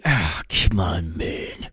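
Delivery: low, slow, fading grumble; imitated flustered president. Flustered president waving off reporters' questions, low slow grumble.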